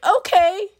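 high, tearful little okay; mock freak-out. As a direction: squeak it as if about to well up. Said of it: "freaking out again".

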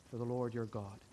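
ordinary talk, nothing marked

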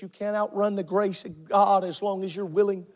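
ordinary delivery

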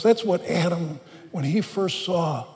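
ordinary talk, nothing marked

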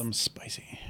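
sultry whisper. Acted. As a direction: breathe it out teasingly. Teasing whisper.

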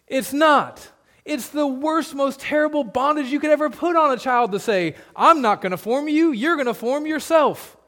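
high, loud preacher building in force; impassioned preaching. Real, not acted. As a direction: preach it with mounting force, pitch climbing to the end.

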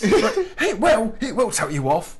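high, laughing insistence; hammed-up mum pressing the point; mock mother insisting. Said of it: "mother insisting".

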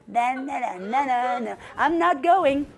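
low, soft sing-song refusal; stubborn refusing mountain. Acted. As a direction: play a mountain dismissively saying no.

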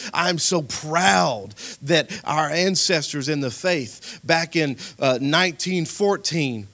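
loud, proud proclamation from the pulpit; full-force preaching. Real, not acted. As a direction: proclaim it fervently to the congregation.

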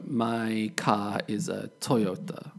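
"car" said "cah" in a British sentence; British pronunciation. Shown as a demonstration. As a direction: leave the R off "car", British style. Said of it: "British English, we would say".

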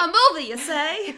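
put-on high, bright old-fashioned lilt; old-timey voice. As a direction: play a quaint old-timer. Mocking old-timey speech.

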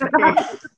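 breathy burst of laughter; short airy laugh; sudden unvoiced laugh. Real, not acted. Laughs at own joke.

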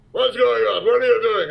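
high, loud imitation of an angry director; Cosmatos yelling. Director to actor for delaying filming, retold yelling. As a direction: yell it like you're furious at the holdup. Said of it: "Cosmatos starts yelling at me for delaying filming".

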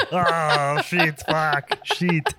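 drawn-out mock Australian cursing; overdone Australian accent for laughs; bad Australian accent. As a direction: groan the swear words in fake Australian. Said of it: "that sounds Australian".

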